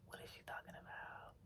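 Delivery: breathless whisper to herself; whispered thought. Retold or imitated. Holding back tears at her friend's words, whispered disbelief. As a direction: whisper it like a private confused thought.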